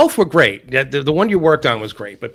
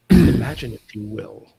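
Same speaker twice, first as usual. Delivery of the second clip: Rod Serling impression; 4 semitones lower than usual. Shown as a demonstration. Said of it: "Rod Serling".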